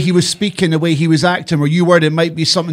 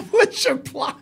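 high, laughing parody of a question; mocking echo; 8 semitones higher, 7 dB quieter than usual. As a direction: echo it in a high, giggly mock voice.

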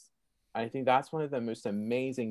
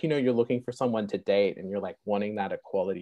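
normal talking voice

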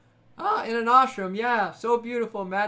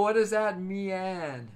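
ordinary talk

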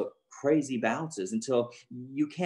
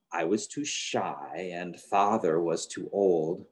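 ordinary delivery, nothing marked

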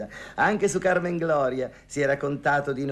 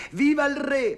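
high, loud patriotic cry; shouted cheer; 14 semitones higher, 6 dB louder than usual. Retold or imitated. Old singer recalling meeting the king, shouting the cheer. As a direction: shout it like the crowd could hear. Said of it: "io gridai".

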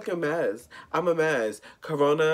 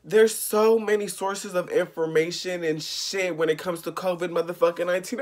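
ordinary delivery

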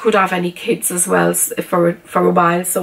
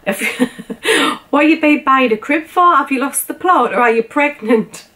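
imagined viewers teasing; 5 semitones higher than usual. Retold or imitated. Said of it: "you're all gonna be thinking".

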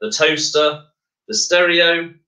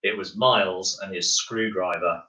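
ordinary delivery

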